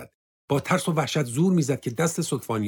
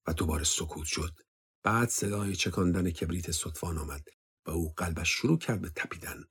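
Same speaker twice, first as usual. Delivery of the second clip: ordinary delivery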